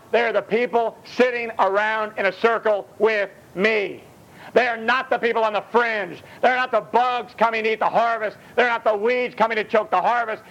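ordinary talk, nothing marked

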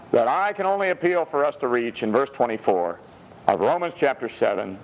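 normal talking voice

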